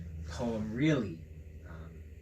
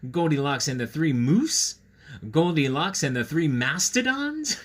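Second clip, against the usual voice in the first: playful questioning titles; 4 semitones higher, 7 dB louder than usual. Acted. Reading crossed-out joke titles.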